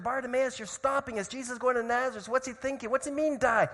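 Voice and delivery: high-pitched exasperated complaint; frustrated. Retold or imitated. Moi crowd annoyed at the beggar holding them up, frustrated. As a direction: fire off exasperated questions. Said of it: "they got so frustrated".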